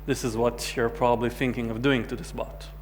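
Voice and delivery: usual speaking voice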